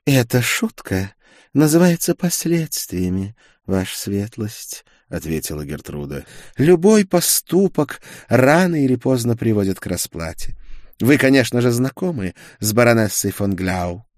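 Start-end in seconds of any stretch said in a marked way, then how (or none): 0.1-4.8 slow, higher, pointed retort; defiant sorceress. A narrator's reading. Gertrude answers His Lordship, coolly defiant. 6.6-14.1 louder, higher, ringing retribution; defiant sorceress. A narrator's reading. Gertrude confronts the duke with his victim, defiant.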